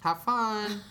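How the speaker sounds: sing-song high have fun; chirpy cartoon farewell; high mock voice. Parents sending kids off.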